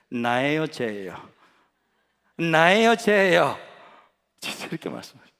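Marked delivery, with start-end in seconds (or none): 2.4-3.6 louder, pressing demand. Retold or imitated. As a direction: insist on an answer from God. Repeated demanding God. (크게 다그치며 묻기)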